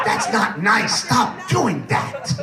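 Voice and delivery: mock scolding, slowing right down; mad wife. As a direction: imitate a wife telling him off, dragging out the last words. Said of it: "my wife would get mad at me".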